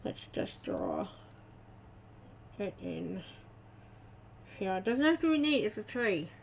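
talking around a marker held in teeth; marker in mouth. Real, not acted. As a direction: speak with a pen clenched in your teeth. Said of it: "I'm holding the marker in my mouth".

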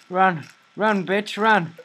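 panicked urging. Real, not acted. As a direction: flee in panic from the monster. Fleeing in panic.

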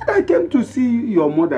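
high, loud, insistent; indignant repetition. Acted. Indignantly repeats the request.

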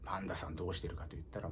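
normal talking voice

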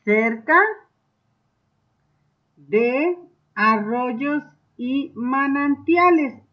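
slow, word-by-word dictation. Shown as a demonstration. Teacher dictating an answer to children, slow. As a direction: give each word time for pupils to write. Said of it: "Y ustedes van a escribir".

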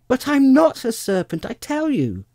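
put-on very high, loud child's objection; exasperated little-girl outcry; indignant protest. A narrator's reading. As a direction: insist at the top of your voice. Alice protests at the accusation.

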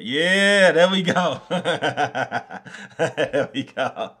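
high, loud laughing triumph; laughing relief. Real, not acted. Streamer finally finds the lemon, loud laughing triumph.

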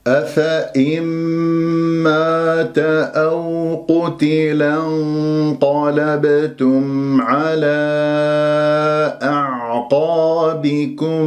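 slow melodic tajwid chant; Quran recitation. Acted. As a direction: recite it sung out, unhurried. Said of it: "جواز الوقف هنا".

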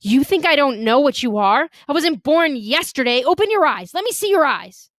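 challenge, then order, pitch climbing; angry challenge. A narrator's reading. Girl accusing the boy of lying, angrily demanding his eyes.

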